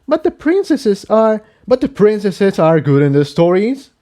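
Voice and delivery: put-on cling to the old stories; Tiptoe, protesting.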